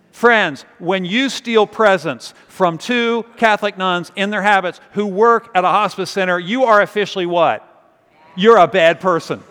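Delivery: loud indignant preacher voice; rally the congregation against the theft; preaching at full force. Acted.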